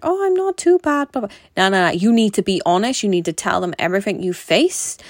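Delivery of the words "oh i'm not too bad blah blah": breezy mock understatement, jumping much higher on not too bad; lightly mocking the polite British patient; mock British politeness. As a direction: play down everything like a polite Brit. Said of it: "don't be British".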